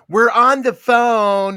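loud, drawn-out mock holler; imitated yell down the line. Imitating someone on a shared line telling off a listener, loud mock yell. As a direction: call it out slowly at an eavesdropper.

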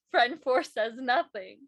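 normal talking voice

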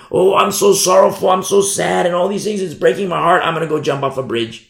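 hammed-up self-pitying woe; mock worldly sorrow. Preacher imitates worldly despair, hammed-up lament. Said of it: "That's worldly sorrow".

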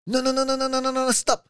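high, loud panicked Italian medic voice; mock Italian accent, frantic no no no. Italian medic stopping the injured rider, high loud accent. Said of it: "That was a great voice".

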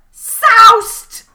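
high, loud gleeful cry; gleeful shout. Acted. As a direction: whoop the word out. Gleeful drunk exclamation.

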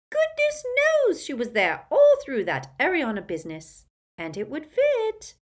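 put-on high, loud, gleeful old woman; gossipy old aunt. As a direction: relish every scandalous word. Muriel voiced in high voice.

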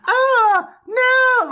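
high, loud helpless wail; mock damsel cry. Mocking the damselfied fighters, high loud wail. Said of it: "hilariously turned into damsels".

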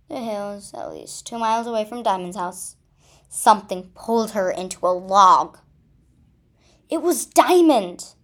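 ordinary delivery, nothing marked

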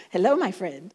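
light mock foreign accent, amused; Dennis's terrible accent. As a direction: tease the husband's favourite YouTuber's accent. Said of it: "It's a terrible accent".

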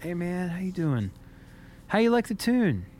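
lightly voiced older drummer asking if he likes the tune; imitating Aaron. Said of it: "was like".

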